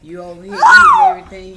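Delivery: loud, fast, high scolding; loud high exasperation. Real, not acted. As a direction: shriek it out in exasperation. Mother scolding daughter playfully.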